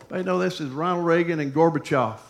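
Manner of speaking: ordinary delivery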